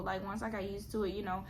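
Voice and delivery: normal talking voice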